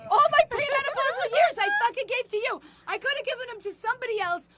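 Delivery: wife ranting at her husband, mock outrage; indignant rant. Real, not acted. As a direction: throw it at him like an accusation.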